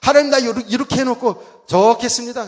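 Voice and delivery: loud, high-pitched retold complaint; angry sarcasm. Preacher re-enacting his prayer in anger at God, sarcastic. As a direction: throw sarcastic praise up at God, acting it out. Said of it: "얼마나 내가 하나님께 화가 나는지".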